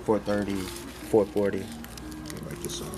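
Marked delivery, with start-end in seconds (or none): 1.6-2.9 breathy whisper, barely voiced; library whisper. Real, not acted. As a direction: whisper it so nobody wakes. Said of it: "I'm whispering".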